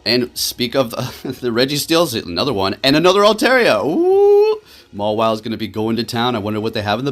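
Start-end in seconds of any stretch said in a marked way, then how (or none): 2.8-4.6 loud, high-pitched exclamation soaring into an "ooh"; excited whoop. Real, not acted. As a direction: shout it out, ending in a long high whoop. Thrilled at another Altaria appearing.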